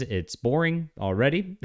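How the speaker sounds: ordinary delivery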